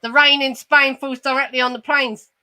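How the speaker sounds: put-on broad Cockney accent, baffled question; quick Cockney pupil's echo; Cockney Eliza Doolittle. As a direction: repeat it back in puzzled Cockney. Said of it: "She's like".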